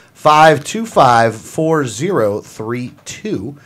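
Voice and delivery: slow, soft number read-back; slow repeat. Shown as a demonstration. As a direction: spell out each digit slowly. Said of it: "That's one eight hundred".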